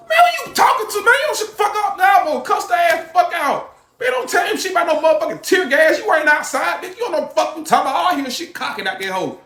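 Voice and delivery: high, furious ranting shout; angry yelling. Retold or imitated. Man scolding his relative on the phone, furious high shout. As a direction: tear into her.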